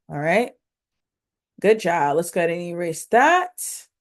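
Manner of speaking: normal talking voice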